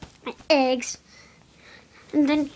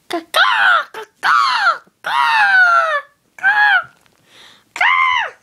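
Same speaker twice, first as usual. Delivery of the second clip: loud, high-pitched bird squawks; bird cawing; 5 dB louder, much slower than usual. Pretend. Playing the angry bird, high screeching caws.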